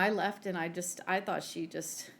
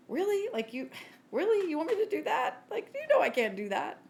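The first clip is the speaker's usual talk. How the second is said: high-pitched, disbelieving pushback; whiny-high "really?", pleading in disbelief; mock incredulous protest; 10 semitones higher, 4 dB louder than usual. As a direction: imitate balking at an impossible request. Acting out reluctant reply to God.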